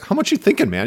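a cocky, high mock voice for the dealer; imitating Avon. Retelling The Wire, the dealer asks Cuddy how much he needs. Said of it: "And he's like".

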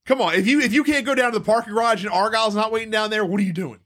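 normal talking voice

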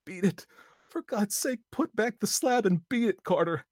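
high, loud pleading cry; piteous cry. A narrator's reading. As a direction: beg him to flee. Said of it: "a piteous cry from Warren".

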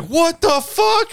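hammed-up stunned squeal; Todd freaking out. Friend stunned by the teeth news, high drawn-out squeal. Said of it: "he's like".

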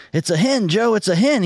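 high, insistent call to a hunting partner; calling out warning. Acted. Friend warns hard-of-hearing grandpa while hunting, calling out high. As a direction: pitch it up to reach deaf ears.

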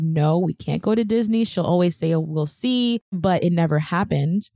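usual speaking voice

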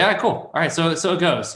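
high, easygoing shrug of a voice; mock carefree resignation; breezy acceptance. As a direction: let it go lightly, like it's no big deal. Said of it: "just embrace it, say".

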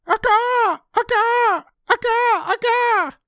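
very high, loud squawks; piercing imitation rooster screech; screaming rooster. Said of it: "it's going to start screaming".

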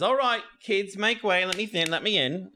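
high, chivvying character voice; high-voiced school guide herding kids; Gallagher's voice. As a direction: pitch it up and clear a path through the kids. Game master voices Gallagher.

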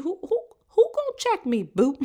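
high, loud sassy "who gonna check me, boo"; sassy comeback. Pretend. Lashing out at the accuser, loud sassy comeback. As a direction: fire back with mock attitude, way up high. Mock sass when defenses rise.

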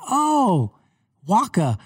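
high excited Waka exclamation; lightly voiced eager friend; imitating Travis. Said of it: "he's going".

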